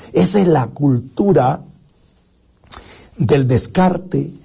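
ordinary delivery

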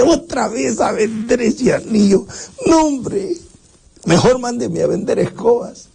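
high, whimpering complaint; whining youth. Acted. Young man groans at selling the ring again, high and whimpering. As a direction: whine like you could cry.

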